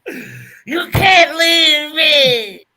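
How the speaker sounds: high, loud, drawn-out wailing plea; drunken wail. Retold or imitated. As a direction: wail it like a heartbroken drunk begging.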